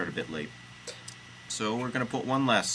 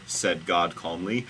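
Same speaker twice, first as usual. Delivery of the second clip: plain reading voice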